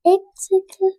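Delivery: whispered echo of "egg circle". Shown as a demonstration. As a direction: breathe "egg circle" without voice. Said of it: "echoes in a whisper".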